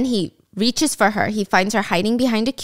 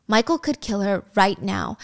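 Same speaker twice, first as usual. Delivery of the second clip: ordinary talk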